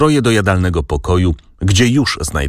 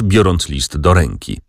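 ordinary delivery

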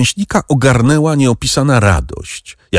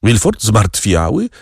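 ordinary delivery

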